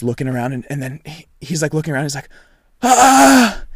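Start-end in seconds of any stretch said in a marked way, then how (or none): 2.8-3.6 loud, high imitated scream; sudden high cry, acting out his scream; retold scream. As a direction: scream out as the injured kid. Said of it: "he starts screaming".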